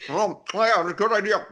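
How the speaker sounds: high, loud comic character voice; put-on agreeing voice. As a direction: chime in loudly as an eager follower. Voicing the troops agreeing with him.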